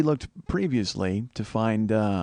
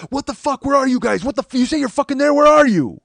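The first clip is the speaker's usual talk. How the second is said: high-pitched screamed text message, building louder; all-caps rage; 14 semitones higher, 9 dB louder than usual. Retold or imitated. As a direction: imitate an incredibly angry friend yelling. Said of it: "in all caps ... incredibly angry, rage-filled".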